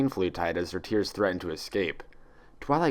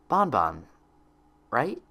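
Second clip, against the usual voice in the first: hesitant; 3 semitones higher, slower than usual. A narrator's reading. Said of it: "she asked hesitantly".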